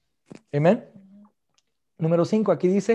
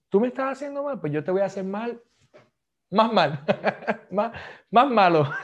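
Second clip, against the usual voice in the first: mock revenge; 4 semitones higher than usual. Acting a vengeful person.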